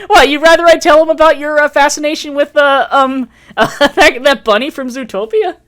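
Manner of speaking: loud, high voice breaking into laughter; laughing tease. Real, not acted. Host threatening to expose friend's fascination, laughing tease. Teasing friend while cracking up.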